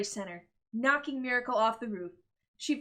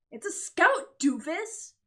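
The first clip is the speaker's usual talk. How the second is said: loud, higher teasing yell; excited shout; 7 semitones higher, 6 dB louder, slower than usual. Acted. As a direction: shake her awake with it. Brother shakes sister, shouting.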